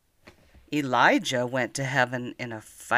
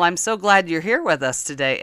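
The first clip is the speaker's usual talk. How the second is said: ordinary delivery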